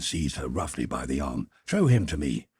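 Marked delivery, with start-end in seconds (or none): 1.7-2.5 higher and louder, pressing demands; urgent exclamation. Acted. Brother demanding his sister point out her lover, exclaiming urgently. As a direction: Jim insisting to see the man, urgently exclaiming. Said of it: "he exclaimed".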